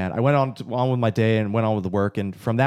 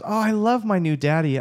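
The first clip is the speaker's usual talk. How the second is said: put-on higher childlike voice; little daughter; 9 semitones higher than usual. Father quoting toddler daughter, higher and sweet. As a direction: lightly voice a small girl. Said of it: "And she goes".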